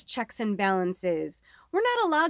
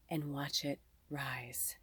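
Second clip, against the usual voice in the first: much softer unvoiced whisper; 7 semitones lower, 15 dB quieter, slower than usual. Reader whispering the spirit's promise. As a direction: breathe it out almost silently.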